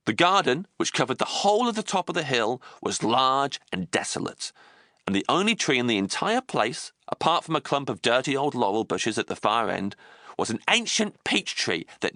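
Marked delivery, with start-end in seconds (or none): none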